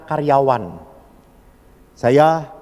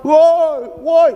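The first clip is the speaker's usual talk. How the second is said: higher, louder playful yell; child calling out; 11 semitones higher, 5 dB louder than usual. Retold or imitated. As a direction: shout like a jumping kid. Child shouting while jumping.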